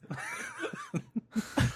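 very high-pitched giggle; high squealing laugh. Real, not acted. Laughing after joke.

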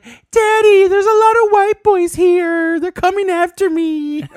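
put-on high, sing-song, slow girly whine; mock a scared girl calling her daddy. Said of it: "he was making fun of the girls".

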